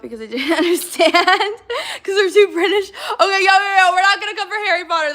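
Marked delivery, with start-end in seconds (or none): 2.0-5.1 laughing. Real, not acted. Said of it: "laughing at comment".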